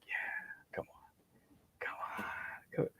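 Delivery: whispered, hungry for the fight; hushed come-on taunt; eager whisper. Retold or imitated. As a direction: egg him on under your breath. Eagerly awaiting the fight quietly.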